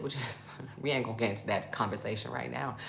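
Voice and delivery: low, soft chuckling aside; amused, dropped-voice dismissal; laughing. Real, not acted. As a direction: laugh quietly through the words as you wave it off. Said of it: "I'm laughing 'cause".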